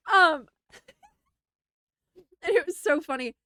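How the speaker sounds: high, teary giggle under the words; real helpless laughter; laughing. Real, not acted. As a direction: still cracking up. Laughing at own story.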